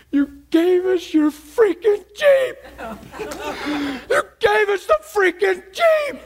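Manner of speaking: high-pitched, hammed-up tearful wail; imitated bawling. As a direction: mimic a man crying his heart out as he speaks. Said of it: "the most emotion and crying that you can imagine".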